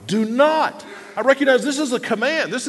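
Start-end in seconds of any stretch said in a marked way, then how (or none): 0.0-0.8 high, firm "not"; emphatic not, pitched up; stress on NOT. Shown as a demonstration. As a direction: hammer "not" as a command. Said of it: "emphasize a different word every time".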